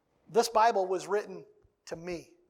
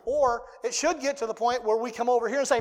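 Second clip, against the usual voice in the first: ordinary talk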